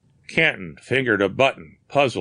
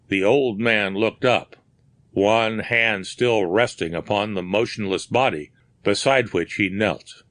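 ordinary delivery

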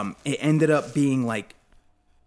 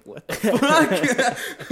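fast, higher, cracking up mid-curse; laughing disbelief; 11 semitones higher, 6 dB louder, much faster than usual. Retold or imitated. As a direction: burst out laughing through the curse. Caller measuring his knee.

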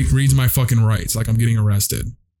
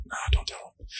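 a hushed voice in his own head; whispered inner voice; 13 dB quieter than usual. Retold or imitated. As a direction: whisper it to yourself, secretive. Inner voice deciding to hide it.

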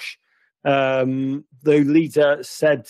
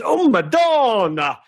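high, drawn-out cry to the fans; exultant cry; 8 semitones higher, 3 dB louder, slower than usual. Acted. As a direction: sing out a long, high cheer.

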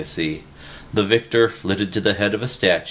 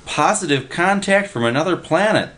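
high excited call of the headline; excited yell; 10 semitones higher than usual. A narrator's reading. Willie yelling the headline at Freddy, high and excited. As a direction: shout it like news. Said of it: "the guy was yelling".

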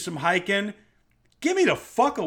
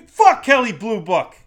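loud, higher outburst; exasperated swearing; angry retort; 5 semitones higher, 10 dB louder than usual. Acted. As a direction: blast back at the haggler. Angry retort to imagined lowballer.

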